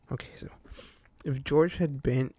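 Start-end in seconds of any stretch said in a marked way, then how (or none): 0.1-0.5 breathy whisper to self; whispered aside. Real, not acted. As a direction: whisper it to yourself.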